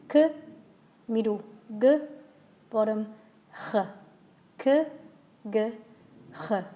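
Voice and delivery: «k», «g», «h» each from a point further down the throat; k g h stepping down. Shown as a demonstration. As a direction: step the sound down from «k» to «h». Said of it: "try from the top".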